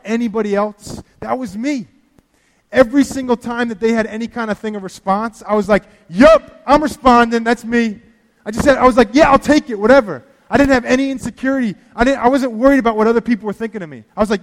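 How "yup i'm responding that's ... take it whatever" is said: eager exclamation. Retold or imitated. Retold eager response in worship.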